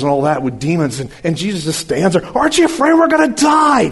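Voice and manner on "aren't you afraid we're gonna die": panicked disciples. Acted.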